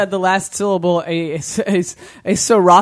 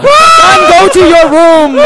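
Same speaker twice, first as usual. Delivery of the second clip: very high, loud comic shout; mock parental outrage; 12 semitones higher, 14 dB louder, slower than usual. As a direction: banish the son in hammed-up outrage. Parent role reacting to the joke.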